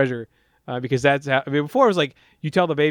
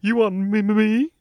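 coy Wimpy; 5 semitones higher, much slower than usual. Retold or imitated. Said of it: "And Wimpy's like".

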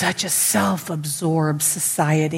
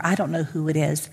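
ordinary talk, nothing marked

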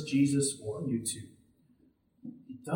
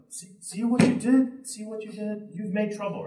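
scolding bystander; 5 semitones higher, 3 dB louder than usual. Acted. Acted bystander scolding the blind man.

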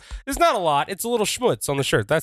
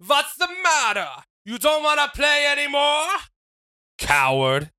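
very high, mocking call; taunting shout; 12 semitones higher than usual. Wrestler taunting the rival who walks off, shouting. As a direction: shout a jeering challenge after him. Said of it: "I shout at Steve".

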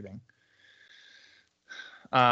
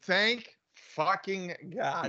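very slow, higher and softer; drawn-out relief; 6 semitones higher, 5 dB quieter, much slower than usual. Real, not acted. Cohost greeting news of the new opening, drawn-out relief. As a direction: stretch each word out in relief.

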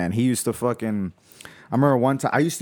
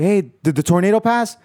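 put-on scared little kid; 8 semitones higher, 4 dB louder than usual. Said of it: "I'm like".

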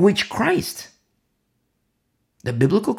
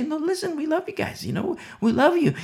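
gentle reassurance; 11 semitones higher than usual. Retold or imitated. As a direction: reassure them tenderly, pitched up. Gently answering mocking friends.

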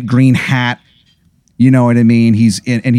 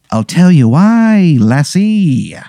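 slow, higher-pitched Irish leprechaun; horrendous Irish accent; 5 semitones higher, slower than usual. Acted. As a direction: draw it out in a hammy Irish lilt. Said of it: "a horrendous accent".

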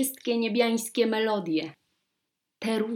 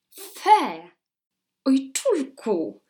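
a lightly voiced spoiled princess going fe; disgusted princess; 5 semitones higher, 4 dB louder, much slower than usual. A narrator's reading. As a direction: wrinkle the nose at father. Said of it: "powiedziała księżniczka".

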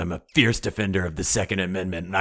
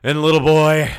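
hyped sales pitch; 8 semitones higher, 8 dB louder than usual. Acted. Sponsor promising help to each family member, loud. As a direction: sell it hard, building to a yell.

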